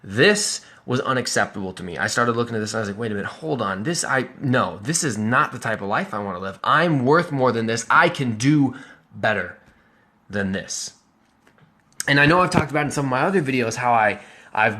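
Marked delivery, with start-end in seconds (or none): none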